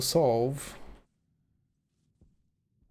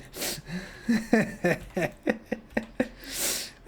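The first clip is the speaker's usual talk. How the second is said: breathy silent laugh; 6 semitones higher than usual. Real, not acted.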